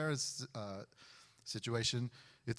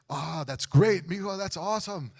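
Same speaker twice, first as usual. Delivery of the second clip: upbeat dad; 5 semitones higher, 7 dB louder than usual. Retold or imitated. Dad on the phone cheering his son's surgery, loud and upbeat. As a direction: cheer the bad news like it's great.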